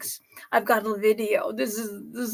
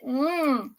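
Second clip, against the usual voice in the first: ordinary talk, nothing marked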